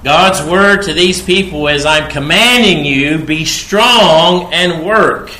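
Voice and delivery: loud, high, slowing on be strong and work; forceful preaching. Acted. Preacher pressing God's command on the people, forceful. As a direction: hammer home the command.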